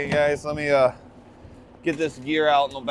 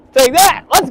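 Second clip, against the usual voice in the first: loud, high victory yell; triumphant shout; 11 semitones higher, 12 dB louder than usual. Real, not acted. Angler celebrating landing the fish, triumphant shout. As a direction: shout it like you just won.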